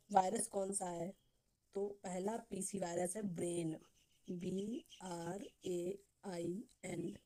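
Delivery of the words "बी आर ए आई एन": letter by letter, very slowly; long pause after each letter; spelling out. Shown as a demonstration. Teacher spelling the virus name for students, slow dictation.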